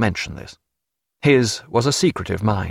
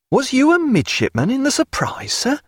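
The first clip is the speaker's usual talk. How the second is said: high-pitched astonished youngster; amazed young cry. A narrator's reading. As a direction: ask it high, wide-open with amazement. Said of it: "cried young Callow, amazed".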